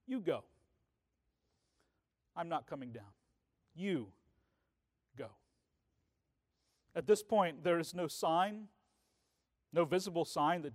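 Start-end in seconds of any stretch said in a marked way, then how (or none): 0.1-5.3 calm firm reply. Retold or imitated. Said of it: "And Jesus says".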